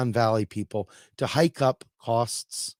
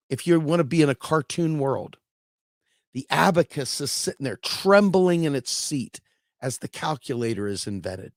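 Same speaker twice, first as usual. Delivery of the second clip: ordinary talk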